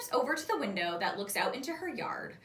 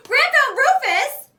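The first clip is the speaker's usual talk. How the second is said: high and loud with joy; thrilled disbelief, much higher and louder; excited; 15 dB louder than usual. A narrator's reading. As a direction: shout it out in delight. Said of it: "I jump up".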